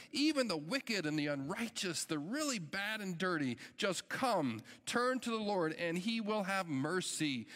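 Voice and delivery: a preacher's rising plea for mercy; fervent preaching. Acted. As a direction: preach it out, urging sinners to turn. Preaching Isaiah at full force.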